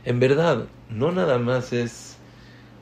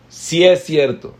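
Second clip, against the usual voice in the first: louder and higher insistence; yelling back; 6 semitones higher, 7 dB louder than usual. Retold or imitated. The other person in the quarrel shouts back louder. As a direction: shout it back to win the argument. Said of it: "gritando más fuerte".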